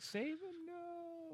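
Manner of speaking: high drawn-out mock wail; mock cry. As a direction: cry it out high and long, playfully.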